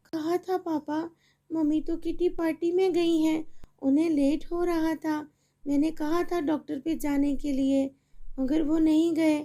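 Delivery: put-on a little girl begging her mother for help; pleading little girl. Feverish daughter.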